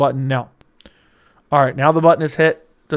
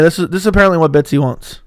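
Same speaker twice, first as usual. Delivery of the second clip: ordinary talk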